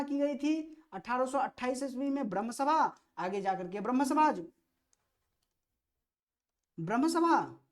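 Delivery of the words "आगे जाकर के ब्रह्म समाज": ordinary talk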